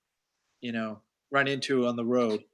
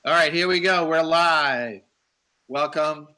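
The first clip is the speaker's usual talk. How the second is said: loud, higher-pitched kickoff; show-opening call; 4 semitones higher, 6 dB louder, slower than usual. Real, not acted. Host kicks off live show.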